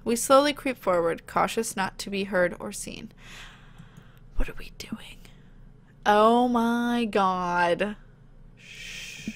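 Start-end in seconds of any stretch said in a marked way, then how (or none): 4.3-5.2 hushed whisper while sneaking in. Pretend. Sneaking partner whispering in the dark. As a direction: keep it breathless and secret.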